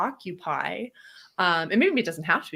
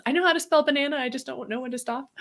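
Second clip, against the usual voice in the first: put-on kid voice joke; 7 semitones higher, faster than usual.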